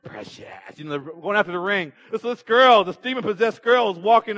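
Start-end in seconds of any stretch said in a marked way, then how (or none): 0.0-0.5 very low, hushed creature voice; quiet, deep film-creature imitation; Lord of the Rings creature. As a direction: say it low and soft, like a creature coveting treasure. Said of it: "reminds me of what really happened on Lord of the Rings".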